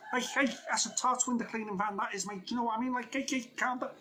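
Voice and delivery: high-pitched, put-on Scouse patter; faux Scouse accent. Imitating Dave for viewers who don't know him, mock Scouse. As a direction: do a bad Scouse impression, pitched right up. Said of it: "he talks like this".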